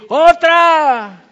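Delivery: loud high cry of otra; shouting encore. Pretend. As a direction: call out otra like a thrilled crowd. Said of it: "y decir".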